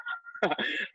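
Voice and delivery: short quiet airy chuckle; soft breathy laugh. Real, not acted. Amused at being scolded for nothing.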